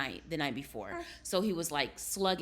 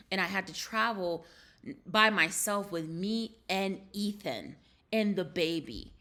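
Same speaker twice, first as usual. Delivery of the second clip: normal talking voice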